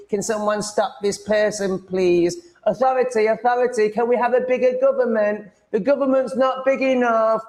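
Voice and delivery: high, slow whiny pleading parody; mock scared viewer. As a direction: whine for authority like a frightened snitch.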